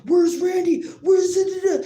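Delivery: high, loud angry yelling; retold screaming uncle. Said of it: "He started screaming at me".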